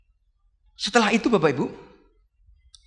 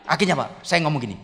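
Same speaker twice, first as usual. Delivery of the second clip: usual speaking voice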